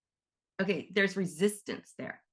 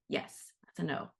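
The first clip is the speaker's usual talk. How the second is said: whispered answer; 6 dB quieter than usual. Pretend. Higher self answers her question.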